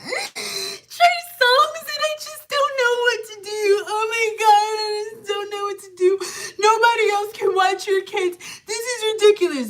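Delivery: high mock sob, half sung; mock sobbing. Client crying about her concert, high mock sob. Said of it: "her just sitting there crying".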